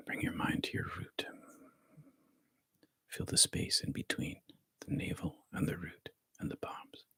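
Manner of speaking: soft, hushed meditation guidance; guided meditation whisper. Acted. Teacher guides the group through body relaxation, soft whisper.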